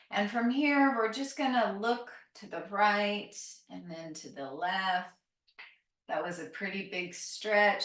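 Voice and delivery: ordinary talk, nothing marked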